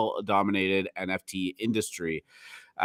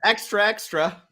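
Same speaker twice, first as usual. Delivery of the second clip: much higher newsboy call; old-time newsboy; 13 semitones higher than usual. Pretend. Joining the boardwalk riff, hammed-up newsboy call. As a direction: cry it like a street paper boy. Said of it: "That might have been British".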